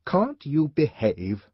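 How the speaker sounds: slow, tight, angry reproach; low angry voice. A narrator's reading. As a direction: hold the anger in. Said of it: "in a low, angry voice".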